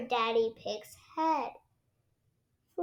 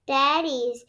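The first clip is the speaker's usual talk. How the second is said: ordinary delivery, nothing marked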